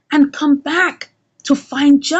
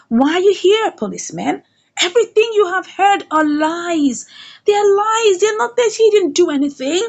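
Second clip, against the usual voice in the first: raised, insistent motherly defence; acted heated protest; 4 semitones higher than usual. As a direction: play a mother hotly denying the charges. Mother defends son to police.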